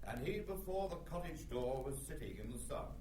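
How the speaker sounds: smeared, unfocused sound; out of phase. Shown as a demonstration. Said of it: "You'll hear this even more clearly".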